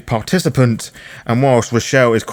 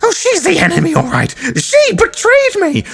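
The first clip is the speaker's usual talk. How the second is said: much higher, wounded and vicious; shrill betrayed fury; 4 dB louder than usual. Acted. Doris refusing to calm down, high and furious. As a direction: shriek it out at the traitor.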